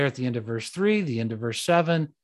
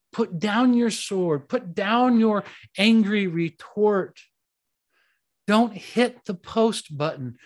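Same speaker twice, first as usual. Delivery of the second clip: slow, higher urgent commands; preaching; 6 semitones higher than usual. Acted. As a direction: urge them to lay down their weapons. String of commands.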